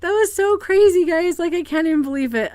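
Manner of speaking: high, loud burst of disbelief; excited exclamation easing down; shaking with excitement. Real, not acted. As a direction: blurt it out, still thrilled. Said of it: "I'm so excited".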